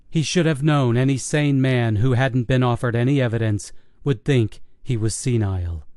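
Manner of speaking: ordinary delivery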